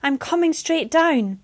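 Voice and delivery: high and loud, slowing on the last words; calling out over the waves; yelled reassurance. Acted. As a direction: shout down that help is coming. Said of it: "she yelled".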